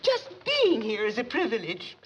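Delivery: ordinary talk, nothing marked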